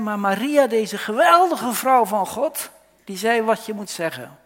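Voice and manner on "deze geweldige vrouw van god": usual speaking voice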